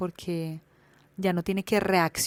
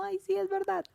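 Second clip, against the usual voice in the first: put-on much higher, syrupy little-girl voice; sweet little girl; 13 semitones higher than usual. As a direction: gush it like a shy child who can't take praise. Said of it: "se pone muy tierna". (voz de niña tierna y melcochuda)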